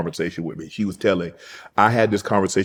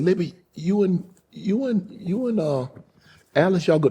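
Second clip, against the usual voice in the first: put-on higher old lady voice, a little hesitant; grandmother's voice; 10 semitones higher than usual. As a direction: put on granny sending folks off.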